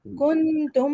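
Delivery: کنتم nun and meem held nasal; recited with ghunna. Shown as a demonstration. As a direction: hum through the nun of کنتم. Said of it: "یہاں پر بھی ہم وزن دیں گے".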